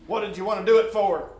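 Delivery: angry scolding woman; stern demand. Acted. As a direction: demand an answer from the boy.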